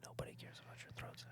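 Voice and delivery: whispered teasing; ASMR whisper. Pretend. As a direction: hush it close to the mic. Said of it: "Why are we doing A S M R right now?".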